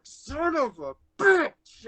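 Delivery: high, slow, drawn-out curse; mock angry dad. Son retelling his dad cursing at the police lights, mock angry. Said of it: "my dad's like".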